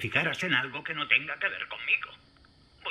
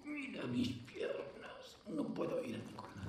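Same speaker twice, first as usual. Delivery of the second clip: injured, in pain; 3 semitones higher, 12 dB quieter than usual. Acted. Herido, atrapado.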